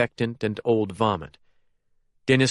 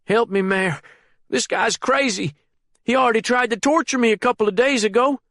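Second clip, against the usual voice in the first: high, fast, frightened plea; chained prisoner begging the mayor, terrified; panicked pleading; 13 semitones higher, 3 dB louder than usual. A narrator's reading. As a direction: beg for help in a panic.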